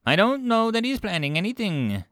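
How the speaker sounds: high alien character voice; declaring. A narrator's reading. Five Clicks answers Kolch about the human, declaring. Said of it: "Five Clicks declared".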